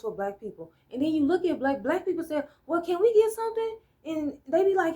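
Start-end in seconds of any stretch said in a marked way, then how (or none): 2.7-3.8 high, fast pleading voice; imitating a meek ask for a little help; mock timid request. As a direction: play a humble asker, pitched way up.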